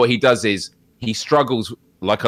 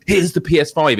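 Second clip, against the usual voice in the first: mock brash reveal; 5 semitones higher, 5 dB louder than usual. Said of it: "it wasn't like".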